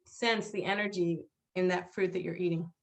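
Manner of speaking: ordinary delivery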